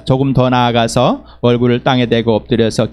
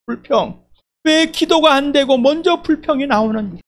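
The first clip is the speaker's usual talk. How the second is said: anguished questioning; 6 semitones higher than usual. Retold or imitated. Anguished self-questioning while weeping.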